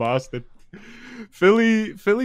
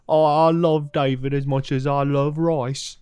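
slow Cockney-tinged put-on girly voice; 3 semitones higher, slower than usual. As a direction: draw it out in a silly Cockney lilt.

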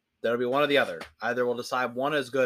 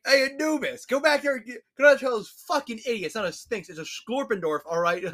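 much higher, fast and louder, ordering a servant god; squeaky boss barking orders down the hall; high cartoon deity sending Anubis off; bossy high god; 12 semitones higher, 3 dB louder than usual. God ordering Anubis about names.

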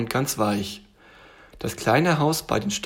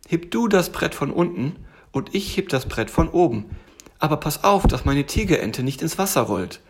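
little tiger voice, pitched up; bossy little tiger; 5 semitones higher than usual. Acted. Tiger directs bear at the bridge, cautioning. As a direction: give orders as a higher-voiced little tiger.

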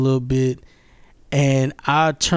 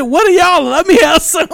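high, loud mock demand; pitched-up, excited pleading; demanding sister; 12 semitones higher, 4 dB louder than usual. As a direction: imitate a sister wanting in.

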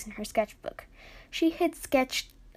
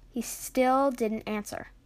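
ordinary delivery

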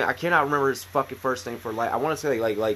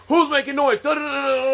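high-pitched mock sergeant yell; drill sergeant; 9 semitones higher than usual. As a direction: imitate a sergeant bawling out recruits. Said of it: "drill sergeant".

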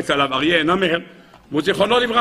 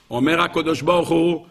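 ordinary delivery

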